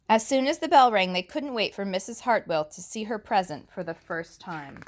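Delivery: put-on lively read-aloud for little kids; picture-book narration; story reading. A narrator's reading. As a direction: read the book brightly to the class. Reads picture book to class.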